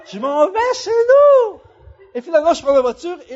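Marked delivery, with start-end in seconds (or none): 0.1-1.6 high, loud, drawn-out yell; exasperated yell. Retold or imitated. Traveler fed up with the Wi-Fi, yelling. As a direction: yell it out slowly, at the end of your rope. Acts out his exasperated thought.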